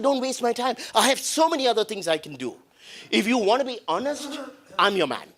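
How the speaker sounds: high-pitched, emphatic mock scolding; retold yell. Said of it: "I yelled at them".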